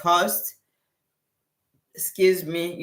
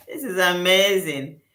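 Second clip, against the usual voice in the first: ordinary talk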